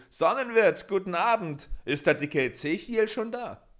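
loud, high-pitched call. A narrator's reading. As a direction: shout a greeting from the street. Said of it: "stieg vom Wagen und schrie".

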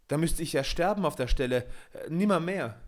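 horrified. A narrator's reading. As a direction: refuse in horror. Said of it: "schrie Peter mit Entsetzen". (Peter weist Michel entsetzt ab, höher und lauter)

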